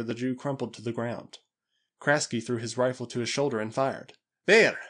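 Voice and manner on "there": sharp, high, loud cry; urgent shout of alarm; cried out. A narrator's reading. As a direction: call out a sudden sighting. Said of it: "he cried".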